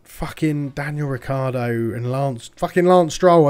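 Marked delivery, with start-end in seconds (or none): 2.6-3.5 louder, sharp curse at the name; real rage at the driver who cost him places; angry outburst. Real, not acted. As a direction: spit out the name in fury.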